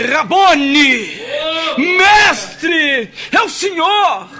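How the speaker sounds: high, loud, ecstatic cry; joyful recognition. Acted. Preacher voicing Maria crying out when she sees Jesus. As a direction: cry out as you recognize him.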